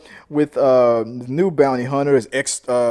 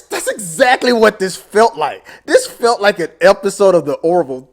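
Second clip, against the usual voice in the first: excited; 5 semitones higher, 9 dB louder than usual. Real, not acted. Ranting excitedly about episode.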